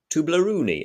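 "Toblerone" said high and loud as "Toobleroonee"; silly pronunciation. Retold or imitated. Boys at the tuck shop mangling the word, silly high voice. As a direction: ham up "Toblerone" with a high silly "roonee" ending. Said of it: "trying to pronounce this word in sillier and sillier ways".

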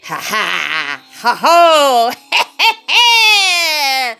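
hammy evil cackle; villain laugh. Acted. Witch and wizard laughing.

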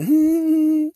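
high-pitched, drawn-out giggle; teasing giggle. Pretend. Host to blushing freaks out there, teasing giggle. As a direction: giggle high at their expense.

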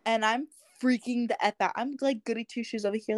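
ordinary talk, nothing marked

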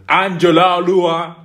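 put-on drawn-out, loud, high Nigerian-accented call; Nigerian parent calling. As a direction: summon your child downstairs in a Nigerian accent. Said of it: "you hear the African accent".

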